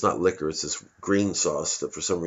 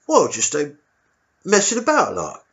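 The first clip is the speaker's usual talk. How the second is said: high, timid squaddie voice; a nervous young private answering his officer; meek soldier squeaking up to the colonel; 7 semitones higher, 4 dB louder than usual. Retold or imitated.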